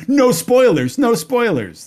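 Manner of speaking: high-pitched, playful mock shout. Telling listeners what to shout at mistaken fans, mock shout. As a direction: call it out like a pretend warning. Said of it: "you shout".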